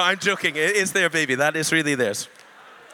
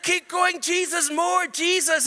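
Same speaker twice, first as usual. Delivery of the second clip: fervent urging; 9 semitones higher than usual. Retold or imitated. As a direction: cry out for more. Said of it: "And then I said".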